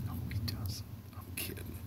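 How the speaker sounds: whispered joking take-back; hushed aside, kidding. Real, not acted. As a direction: drop to a whisper to undo the joke. Joking aside after fake claim.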